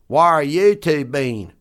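slow, put-on hillbilly voice; hillbilly drawl. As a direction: play a backwoods hillbilly. Hillbilly sketch dialogue.

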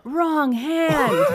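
mock heckle from the family, high and drawn out; high-pitched retold yell, stretched long. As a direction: call it out like a relative from the pews. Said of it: "they yell out".

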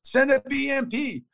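high, slow put-on voice of Iraqi soldiers on the radio; voicing the Iraqis. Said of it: "They're like".

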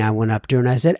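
ordinary talk

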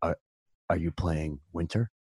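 low, soft, flat monotone; monotone Ollie voice. Retold or imitated. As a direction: drone it quietly like a gloomy warlock. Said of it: "he had a very monotone voice".

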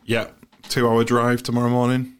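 usual speaking voice

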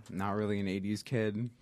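ordinary delivery

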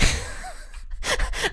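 soft, high, airy giggle; high self-amused chuckle; breathy laugh. Real, not acted. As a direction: laugh quietly at yourself. Laughs at own stomach ache admission.

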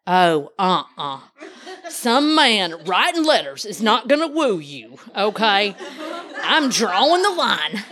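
drawn-out mock fatherly refusal; disapproving dad. Dad refusing his daughter's letter-writing suitor, mock-stern. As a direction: imitate a dad laying down the law, slowly. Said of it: "my dad was like".

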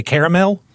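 caramel said in full as "CARE-uh-mel"; three-syllable caramel. Real, not acted. Co-host corrects host, caramel in full as CARE-uh-mel. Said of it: "Are you trying to say".

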